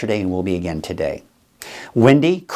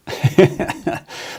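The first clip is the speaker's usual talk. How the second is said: real, rapid chuckle; higher, bubbling laugh; amused chuckle; 10 semitones higher than usual. Real, not acted. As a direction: chuckle at your own little joke. Jokes about pie for breakfast.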